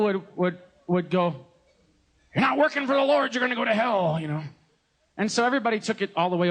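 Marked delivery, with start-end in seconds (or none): 2.3-4.2 high, pushy warning voice; preacher threatening the congregation, mocking warning tone; mock stern preacher. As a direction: sell the hellfire threat with a mocking edge.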